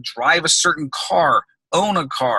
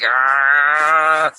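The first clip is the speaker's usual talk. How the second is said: long, held groan of dread; hammed-up "ahhh" held on one note; drawn-out groan; 4 dB louder than usual. Business coach mimics dreading an unhappy client's call, mock groan.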